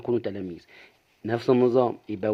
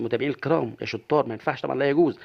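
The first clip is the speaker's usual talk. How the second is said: usual speaking voice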